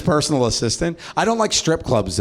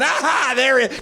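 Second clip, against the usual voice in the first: much higher delighted aha; mock excited greeting; 12 semitones higher than usual. Shown as a demonstration. Comic demos his trick for forgotten names, high delighted. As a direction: greet them like a long-lost friend. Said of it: "Mine is".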